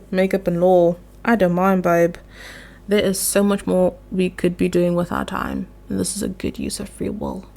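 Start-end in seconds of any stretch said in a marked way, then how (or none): 5.9-7.4 a sudden low, faster put-on voice for a joke; mock deep voice. Vlogger joking to viewers about free will, in a put-on low voice. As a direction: drop the voice much lower as a mock aside.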